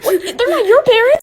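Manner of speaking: high shocked cry; shocked shout. Real, not acted. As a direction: scream it in disbelief.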